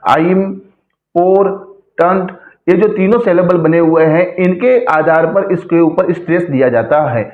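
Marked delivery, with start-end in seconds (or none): 0.1-2.3 "आइम", "पोर", "टंट" with clear pauses between; syllable by syllable: "आइम... पोर... टंट"; split into syllables. Shown as a demonstration. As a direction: say आइम, पोर, टंट as three separate beats. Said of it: "तीनों हैं syllable अलग अलग".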